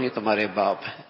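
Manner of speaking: low, quick تمہارے باپ ہیں, as information; informing. Shown as a demonstration. Giving the child news, low matter-of-fact statement. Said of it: "خبر".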